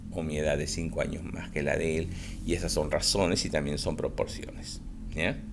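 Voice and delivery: usual speaking voice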